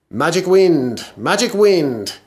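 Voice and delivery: loud high magic incantation; calling out. Acted. Magician calls the wind.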